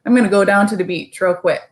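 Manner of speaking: fast, choppy Barbadian accent. Shown as a demonstration. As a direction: chop the words up quick like a Bajan. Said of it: "Barbadians would be".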